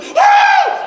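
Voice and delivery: a very high, loud yelp of shock; startled shriek. Retold or imitated. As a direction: jump out of your skin. Kid startled by talking brownies.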